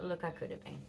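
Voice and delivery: ordinary talk, nothing marked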